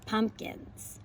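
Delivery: normal talking voice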